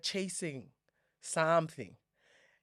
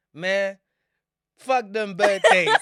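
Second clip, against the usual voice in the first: laughing mock dismissal; 4 semitones higher, 11 dB louder, slower than usual. Mock dismissive quote of herself.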